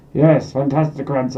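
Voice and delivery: ordinary talk, nothing marked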